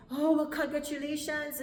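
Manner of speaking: high, loud gushing; excited congratulations. Retold or imitated. Visa official congratulates the daughter, gushing excitedly. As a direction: imitate an impressed official gushing.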